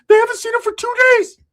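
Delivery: very high, loud frantic gibberish voice; squeaky Ricky. Retold or imitated. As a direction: babble it high and panicked.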